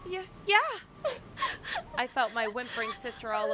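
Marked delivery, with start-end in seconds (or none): 0.1-0.6 high, crying reply; through tears. Acted. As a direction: answer while crying. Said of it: "Polina spoke through tears".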